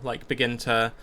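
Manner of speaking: normal talking voice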